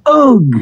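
sharp high exclamation of disgust; a yelp of revulsion as goo splatters; disgusted ugh. A narrator's reading. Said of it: "he exclaimed and stopped".

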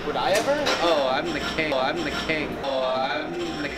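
ordinary talk, nothing marked

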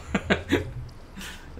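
breathy mock evil laugh; mock villain laugh. Gamer laughing like a villain over the kill.

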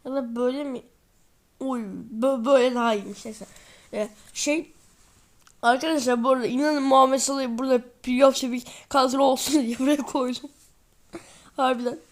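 ordinary delivery

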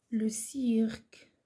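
slow, drawn-out dictation. Shown as a demonstration. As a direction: dictate it slowly as one phrase. Dictating words for pupils to write. (إملاء بطيء ومتصل)

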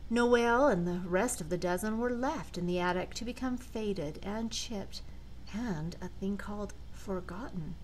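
ordinary delivery, nothing marked

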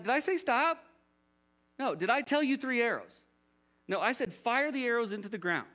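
high, indignant mock scolding; ticked off. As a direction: fire off outraged rhetorical questions. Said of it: "Elisha's legitimately ticked".

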